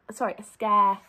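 ordinary delivery

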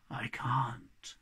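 hushed, sorrowful reply; sad. Acted. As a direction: admit it quietly and regretfully. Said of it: "replied the Hobgoblin sadly".

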